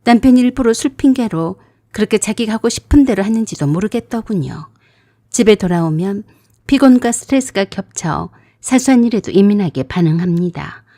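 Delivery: ordinary delivery, nothing marked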